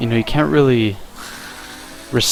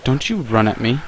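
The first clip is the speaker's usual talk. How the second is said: ordinary talk, nothing marked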